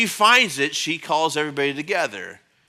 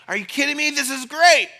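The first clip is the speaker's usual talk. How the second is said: excited brother; 9 semitones higher, 4 dB louder than usual. Retold or imitated. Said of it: "it's like".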